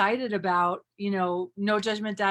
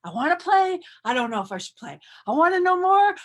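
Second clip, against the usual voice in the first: higher and louder, mock-eager; eager wanting; 8 semitones higher, 7 dB louder, faster than usual. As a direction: blurt it out like someone itching to join in. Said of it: "people are like".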